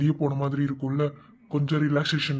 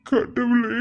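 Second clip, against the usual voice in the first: anguished cry; 9 semitones higher, 4 dB louder, much slower than usual. Acted. Brother distressed by sister.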